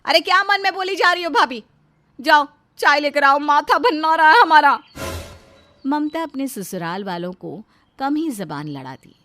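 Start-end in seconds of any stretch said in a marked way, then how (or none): 0.1-4.8 loud, peevish sister-in-law; louder, exasperated command; irritated bossy order. Acted. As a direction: order her off with mounting annoyance. Rita snaps orders at sister-in-law.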